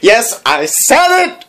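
high, slow, proud declare; high triumphant yes I said it; triumphant exclamation. Real, not acted. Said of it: "repeated louder declaration".

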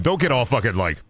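ordinary talk

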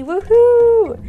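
high loud woohoo; cheer. Real, not acted. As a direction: celebrate them. Welcoming new viewers cheer.

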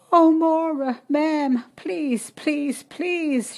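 high, loud, desperate begging; a frightened girl in labour begging Maura, sobbing; sobbing plea. A narrator's reading. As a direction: beg her through sobs. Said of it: "she sobbed".

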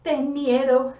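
usual speaking voice

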